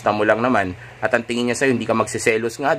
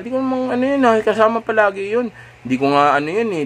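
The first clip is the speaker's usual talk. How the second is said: hysterical; 11 semitones higher than usual. Acted. Girlfriend flaring up over the coworker question, hysterical. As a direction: lose it in a panicky outburst. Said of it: "nag-hysterical".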